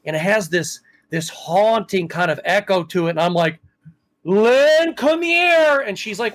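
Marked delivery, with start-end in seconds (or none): 4.2-5.8 high, loud call to the next room. Retold or imitated. Husband calling his wife to come hear the music, loud. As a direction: shout for her to come quick.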